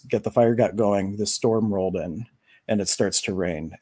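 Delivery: ordinary talk, nothing marked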